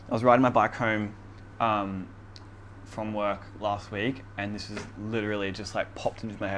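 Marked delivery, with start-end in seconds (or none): none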